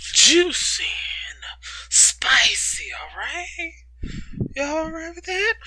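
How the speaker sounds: juicy, spicy, whispered playfully; whispered tease rising high on spicy; teasing whisper. Real, not acted. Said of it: "teasing the listeners about the chapters".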